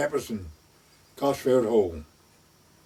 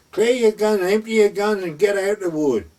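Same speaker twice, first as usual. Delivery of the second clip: raised, higher, louder barked order; a retold furious order, higher and louder; retold angry outburst; 6 semitones higher, 4 dB louder than usual. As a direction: re-enact yelling at the guns to clear out. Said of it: "I'm sorry for my outburst".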